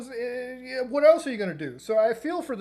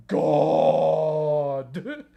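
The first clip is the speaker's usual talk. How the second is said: mock ghost voice; 5 semitones lower, 5 dB louder than usual. Answering as the ghost, trolling.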